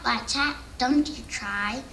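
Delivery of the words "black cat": usual speaking voice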